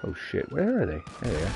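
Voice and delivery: normal talking voice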